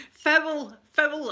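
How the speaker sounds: shouting foul. Retold or imitated. Fan yelling at the telly about a foul, shout. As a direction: bellow foul at the screen. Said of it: "I'll be shouting all that".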